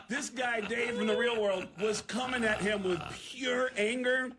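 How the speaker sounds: normal talking voice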